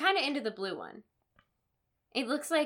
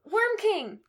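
excited exclamation; 11 semitones higher, 6 dB louder than usual. Real, not acted. Gasping at worm swarms.

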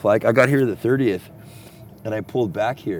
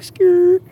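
sharp, much higher and louder "skirt" skid noise; imitated skid; 8 dB louder than usual. Describing the neighbor braking hard beside his tent, loud high mock skid. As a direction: spit out the tire screech like a kid on a BMX. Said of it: "He's like".

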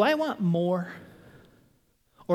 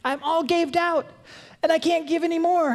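higher, whining complaint; mock exhausted; 8 semitones higher than usual. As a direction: whine that you're spent. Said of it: "Gaved out, is that the word?".

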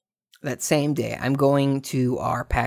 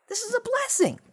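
mock delight.

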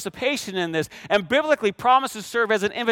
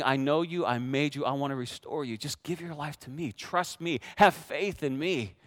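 soft, lower-pitched reassuring plea; tender appeal; 7 semitones lower, 8 dB quieter than usual. Acted. Preacher voices God inviting people back, tender and hushed. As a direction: speak gently and low, inviting trust.

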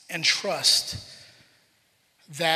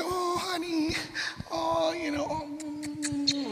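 high, soft mock cooing; imitated soothing mother; 9 semitones higher, 6 dB quieter, much slower than usual. Wife comforting son with pinched fingers, imitated cooing. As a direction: coo like a mother over a hurt child.

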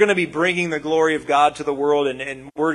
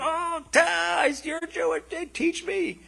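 high, soft begging voice; pleading plea; 13 semitones higher, 5 dB quieter, slower than usual. Retold or imitated. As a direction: beg to be taught, reaching up high. Visitors plead in Jerusalem.

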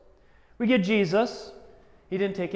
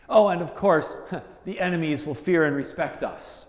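ordinary talk

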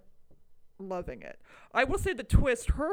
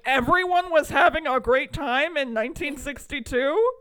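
loud, higher, rising disbelief; incredulous; 4 semitones higher, 9 dB louder than usual. Retold or imitated. Viewer challenging the movie's rosy opening, loudly incredulous. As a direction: throw the claim back as an outraged question. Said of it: "I was like".

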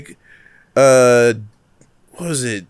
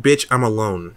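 ordinary delivery

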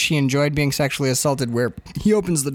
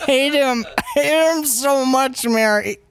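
hammed-up drunken wail; 9 semitones higher, slower than usual. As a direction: whine it out like a weepy drunk. Drunk Jack wails at Mary.

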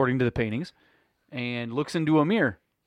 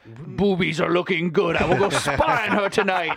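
raised, hammed-up olde English voice; mock archaic prophet; 3 semitones higher than usual. Host joking as Nostradamus spying on neighbours, mock archaic voice. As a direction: play a peeping old prophet for laughs.

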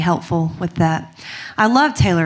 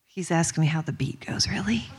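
real whisper, softer, aside to the crowd; 3 semitones lower, 12 dB quieter than usual. Real, not acted. Telling the audience about Taylor, whispered. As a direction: whisper it off-mic with disbelief.

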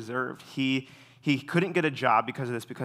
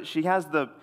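usual speaking voice

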